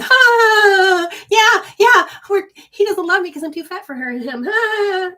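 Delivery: hammed-up tearful outcry; mock wailing women. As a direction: wail it like heartbroken women. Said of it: "all the women are out there going".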